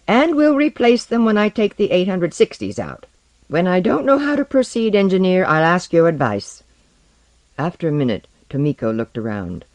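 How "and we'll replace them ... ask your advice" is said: high-pitched, scornful rebuff; irritated retort. Acted. Colleague rebuffs Exwana's unwanted advice, irritated and scornful. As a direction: put the engineer in his place.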